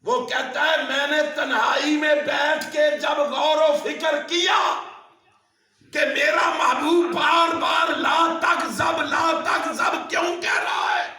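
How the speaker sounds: high, loud, ringing sermon shout; impassioned preacher's shout, pitched high; full-force preaching. Real, not acted. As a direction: preach it out loud to the whole congregation.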